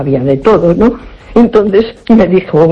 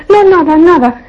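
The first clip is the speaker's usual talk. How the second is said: higher-pitched imitation, brushing it off; dismissive denial; 9 semitones higher than usual. Card reader covering up what she saw, imitated dismissive denial. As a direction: wave away the question in a raised voice.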